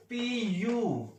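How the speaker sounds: each letter drawn out very slowly; teacher to students, spelling out P of u slowly; slow dictation. Shown as a demonstration. Teacher writes expression on board.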